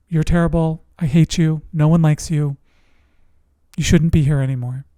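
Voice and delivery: usual speaking voice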